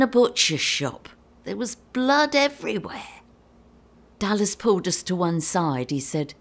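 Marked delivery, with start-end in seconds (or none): none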